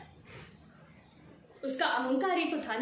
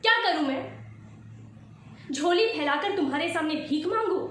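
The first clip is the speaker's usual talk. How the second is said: raised, indignant voice pressing each question; impassioned confrontation. Acted. Wife challenging husband over double standards, heated and indignant. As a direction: throw heated questions at him.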